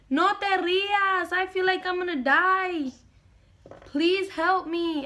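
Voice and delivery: high, slow pleading; scared plea. Retold or imitated. To her laughing aunt, high, slow frightened plea. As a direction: beg her to stop laughing and help.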